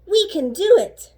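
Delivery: rally the gang with it; eager cheer. A narrator's reading. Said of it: "needed the hippo to say".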